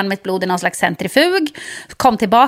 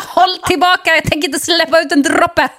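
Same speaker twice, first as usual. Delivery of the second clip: lightly voiced stubborn heart, higher; 6 semitones higher than usual. Her heart refusing the blood, mock defiant. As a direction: give the heart a cheeky higher voice.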